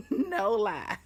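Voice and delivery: high laugh through the words. Real, not acted. To her viewers, laughing at her childlike panic. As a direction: laugh it off, no lie. Laughing about missing mom's fun.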